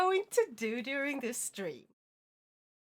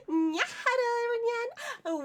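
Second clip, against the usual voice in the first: cutesy voice; 9 semitones higher than usual. Acted. Vtuber cutesy stream greeting.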